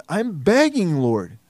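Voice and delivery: a restrained plea that sinks away at the end; pleading. Acted. Preacher voicing David crying out to his God, pleading.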